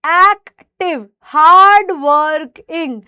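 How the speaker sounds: एक्टिव and हार्ड वर्किंग slowly broken into syllables; slow, separated beats on एक्टिव, हार्ड वर्किंग. Shown as a demonstration. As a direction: sound out एक-टिव, हार्ड-वर-किंग. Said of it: "तो बोलकर देखो".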